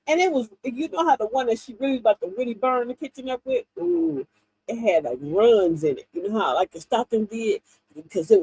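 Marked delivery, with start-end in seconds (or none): none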